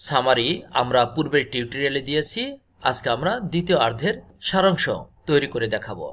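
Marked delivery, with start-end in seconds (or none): none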